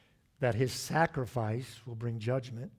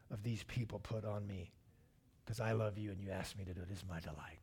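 soft, low, intimate prayer to a father; hushed devotion, sinking quieter to the end; Jesus, tender submission; 4 semitones lower, 10 dB quieter than usual. Acted. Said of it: "Can you picture Jesus saying that".

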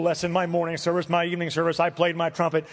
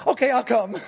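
quick, higher-pitched excited yes; eager acceptance; 4 semitones higher, 3 dB louder than usual. Retold or imitated. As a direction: jump at the offer, excited. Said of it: "I was so excited about".

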